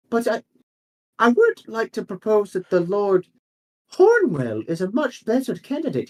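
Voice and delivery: high-pitched councillor making a case; high, halting aristocratic objector; haughty objector. Acted. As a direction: raise the voice high for a hesitant, formal objection. Game master voices new councillor.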